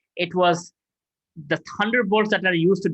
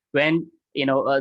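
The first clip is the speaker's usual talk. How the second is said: ordinary talk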